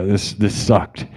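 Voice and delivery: ordinary talk, nothing marked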